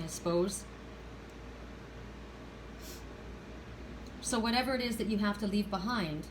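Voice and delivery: quiet, moved voice; emotional, subdued. Real, not acted. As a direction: speak gently through real emotion. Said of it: "I'm getting very emotional".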